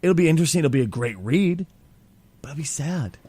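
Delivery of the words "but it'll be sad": soft unvoiced whisper, wistful. Real, not acted. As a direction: drop to a whisper on the sad part. Sad musing about a loved character.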